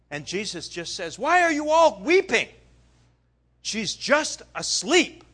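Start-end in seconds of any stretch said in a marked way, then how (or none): 1.2-5.2 loud challenge. Acted. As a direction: call out over the wailing crowd. Jesus confronting wailing mourners.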